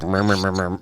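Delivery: low-key mock mumble; imitated mumbling. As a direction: mumble nonsense syllables under your breath. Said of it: "mumbling a little bit".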